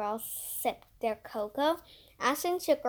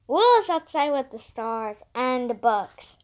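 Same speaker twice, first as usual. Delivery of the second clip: shouted; 8 dB louder than usual. A narrator's reading. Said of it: "shouted Emerson".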